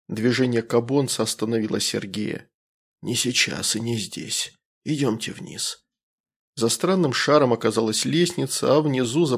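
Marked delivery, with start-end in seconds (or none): 3.0-5.8 soft, rustling alien voice; calm and hushed, like leaves. Acted. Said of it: "голос остался спокойно шелестящим".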